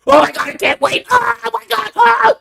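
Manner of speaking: squealing, shouting mock fangirl; hyped influencer impression. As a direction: overact an influencer's giddy excitement. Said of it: "you wanna know what an influencer do?".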